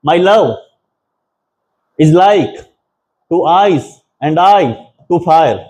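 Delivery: slow line, paused in pairs, leaning on "love", "like", "ice", "I", "fire"; iambic stress reading. Shown as a demonstration. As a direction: scan it foot by foot: light "My", stressed "love", onward in pairs. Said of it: "अब इसको पढ़ते हैं हम". ("My love is like to ice and I to fire" को रुक-रुक कर, हर दूसरे शब्द पर ज़ोर देकर पढ़ें)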